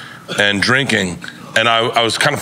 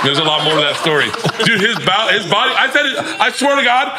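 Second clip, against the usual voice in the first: ordinary talk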